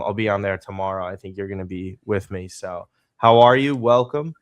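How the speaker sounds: ordinary delivery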